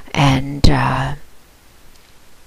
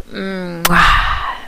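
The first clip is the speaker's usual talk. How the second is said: a soft, airy kiss sound; blown kiss; 2 semitones higher, 4 dB quieter than usual. Real, not acted. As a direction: blow him a kiss. Kiss goodbye to slave.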